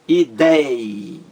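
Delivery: ideia with DEI held long, small separate a after it; stress on DEI. Shown as a demonstration. Teacher showing the strongest syllable, i-DEEEI-a stressed. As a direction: stretch the dei in ideia, then release the a. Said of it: "A mais forte é dei".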